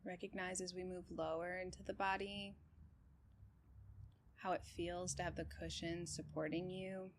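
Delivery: calm, even meditation guide with long pauses; unhurried body-scan voice, settled and steady; guided meditation. Real, not acted. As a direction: guide them gently through breath and body, leaving space between phrases.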